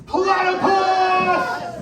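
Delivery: long stretched high shout of the answer; crowd shout. Shown as a demonstration. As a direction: lead the crowd in a big drawn-out yell. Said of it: "we have to shout it at them".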